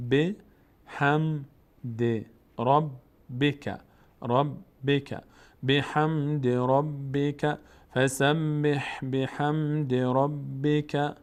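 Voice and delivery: فسبح بحمد ربک built up word by word, very slowly; slow recitation drill. Shown as a demonstration. Teacher builds the verse for learners, slow and careful. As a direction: recite piece by piece, then join. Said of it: "teacher builds the verse".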